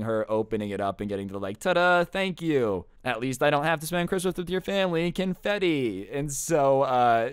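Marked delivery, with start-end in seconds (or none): 1.6-6.1 sing-song fake cheer, stretched out at the start; gleefully sarcastic thanks; mock celebration. Imagined sarcastic celebratory text reply.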